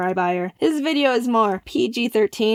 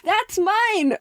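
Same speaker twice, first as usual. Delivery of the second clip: much higher, delighted claim; thrilled exclaim; 10 semitones higher than usual. Real, not acted. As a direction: claim it with pure glee. Seeing own slogan used.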